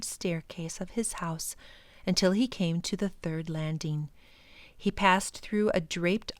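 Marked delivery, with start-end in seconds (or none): none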